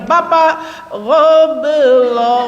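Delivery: slow, drawn-out "gha belum" with a throaty gha; gha pronunciation drill. Shown as a demonstration. Wife practising the guttural gha on her husband. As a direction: hold the "gha" long and guttural. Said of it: "ibu bilang begini".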